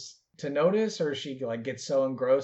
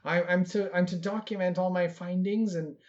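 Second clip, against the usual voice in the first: put-on earnest young druid; 5 semitones higher than usual. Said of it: "she'll be like".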